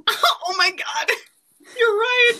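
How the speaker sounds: laughing high and breathless; cracking up while talking. Real, not acted. Cracking up at the Jojo joke.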